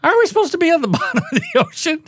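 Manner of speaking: high, fast, laughing through the words; mock disbelief, cracking up; laughing mock question. Mocking scene, laughing high voice.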